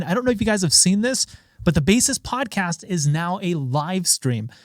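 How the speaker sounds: ordinary talk, nothing marked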